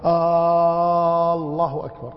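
آه held long and level before الله أكبر; drawn-out questioning alef. Shown as a demonstration. Teacher mimics a common mistake in takbir, opening vowel overstretched. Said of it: "يعني بعضهم يقول".

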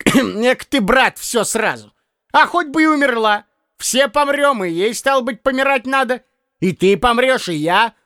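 put-on a fussy old clerk scolding a younger man; hint of a grumbling old man. A narrator's reading. Piskarev philosophising.

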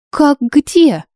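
high wide-eyed question; theatrical surprise. A narrator's reading. Bride answering the judge's question, theatrical surprise. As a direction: pitch it way up in feigned astonishment. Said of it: "Я картинно округлила глаза".

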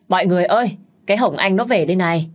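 to, gọi vọng ra cả sân; gọi mọi người ra xem, nhanh và to; gọi lớn. Acted. Họ hàng gọi báo tin. (gọi to báo tin)

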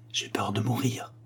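hushed, whispered confession; murmure un peu honteux. A narrator's reading. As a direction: whisper it softly, a little ashamed. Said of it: "murmura, un peu honteuse et presque bas". (murmure honteux, presque tout bas)

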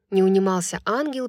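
usual speaking voice

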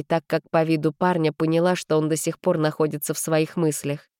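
usual speaking voice

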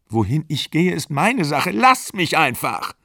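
high-pitched, upset brush-off; acted exasperation in a quarrel; defiant retort. A narrator's reading. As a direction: snap back, slowing on the last plea.

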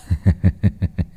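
put-on low drunken cackling laugh; a son laughing crazily at his father; soft unhinged chuckle; mad cackle. A narrator's reading. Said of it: "cười lên sằng sặc".

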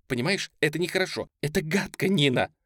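высокий взволнованный возглас; слегка плаксивое возмущение; со слезами. A narrator's reading. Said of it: "Со слезами воскликнула она". (воскликнуть повыше, будто сквозь слёзы)

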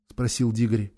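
plain reading voice

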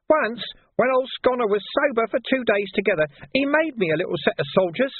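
a wheedling gutter lad taking offence; cheeky street urchin Nobby, aggrieved. Acted. Nobby's voice held.

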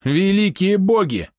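exclaiming. A narrator's reading. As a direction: cry out to the gods. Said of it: "воскликнул Вадим".

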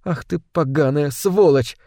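ordinary delivery

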